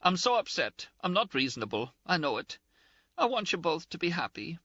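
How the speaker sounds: high-pitched, indignant, close to tears; Ferguson's scolding. A narrator's reading. Ferguson voiced, upset.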